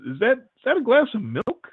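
a startled, puzzled blurt, rising high at the end; retelling his own baffled blurt at the waiter; blurted question. Retold or imitated. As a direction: ask it like something can't be right on the tray. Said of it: "I just kind of blurted out".

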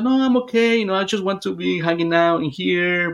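imitated child, high at first then easing down. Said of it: "She changed her voice for a, a child".

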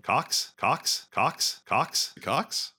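each COX lilting sharply upward; teasing repetition. Shown as a demonstration. Teasing a friend who likes hearing it, questioning lilt. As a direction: repeat COX as a high, rising question. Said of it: "hearing Cox said over and over again".

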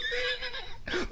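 high-pitched breathy laugh. Real, not acted. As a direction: burst out laughing. Laughs at own Karen bit.

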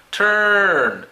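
"Turn" held long, loud and high, falling; long vowel demo. Shown as a demonstration. Teacher models long sounds with "Turn", long vowel demo. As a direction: stretch the vowel of "Turn" out loudly. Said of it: "Los sonidos largos".

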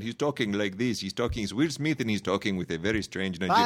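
mock Nigerian accent, imitating an actor; imitation of an actor's Nigerian accent. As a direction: put on a strange Nigerian accent. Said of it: "doing a Nigerian accent".

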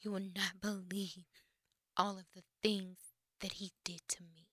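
soft, fading to a whisper, like an actress; dramatic hush. Retold or imitated. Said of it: "she says it like this".

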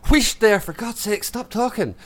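high, exasperated Scottish shushing; Scottish hushing. Shown as a demonstration. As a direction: shush someone crossly in a Scottish accent. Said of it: "That's how you say it".

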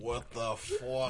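slow, hushed, drawn-out curse; Tommy impression. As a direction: stretch it out softly, imitating a TV tough guy. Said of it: "That was a perfect Tommy".

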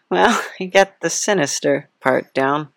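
ordinary talk, nothing marked

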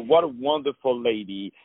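normal talking voice

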